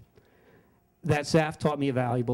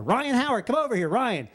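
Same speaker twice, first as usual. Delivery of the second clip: higher, calling to a player; 6 semitones higher than usual. Retold or imitated. Friend Saf calling Ryan Howard over from the stands, hailing. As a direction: shout him over from the stands.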